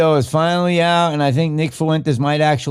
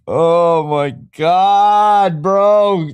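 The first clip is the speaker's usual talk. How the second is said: slow, louder stunned gasp; shocked; 5 semitones higher, 4 dB louder, much slower than usual. Real, not acted. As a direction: drag out oh my God in shock. Shocked reaction.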